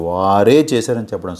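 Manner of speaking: వారే stretched long, the rest unstressed; slow down and lean hard on వారే; long, emphatic వారే; emphatic stress. Shown as a demonstration. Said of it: "emphasize చేసి చెప్తున్నాం".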